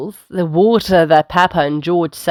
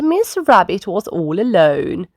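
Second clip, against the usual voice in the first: plain reading voice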